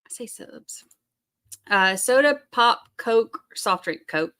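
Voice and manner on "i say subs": whispered echo. Real, not acted. Repeats own answer, whispered.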